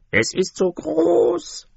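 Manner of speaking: high, slow jeering chorus; mocking ducks. A narrator's reading. All the yard animals mock the duckling, high and slow. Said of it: "sagten alle".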